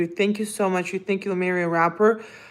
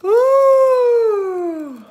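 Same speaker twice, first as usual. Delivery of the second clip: drawn-out high coo; loud, sustained high "ooh"; long high "ooh"; 13 semitones higher, 7 dB louder than usual. Real, not acted.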